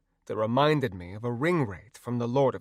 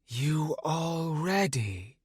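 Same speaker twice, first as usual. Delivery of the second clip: slow, soft, ghostly question; eerie wraith voice; 4 semitones higher, 3 dB quieter, much slower than usual. Acted. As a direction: ask like a drifting spirit. Wraith Nanaya asks.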